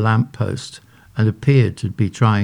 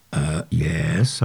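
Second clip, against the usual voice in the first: cautious; 5 semitones lower, 4 dB quieter than usual. A narrator's reading. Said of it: "I replied cautiously".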